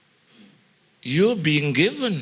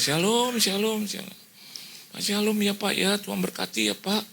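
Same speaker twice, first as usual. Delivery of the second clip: cheerful greeting; 7 semitones higher than usual. Retold or imitated. Pastor returns the greeting.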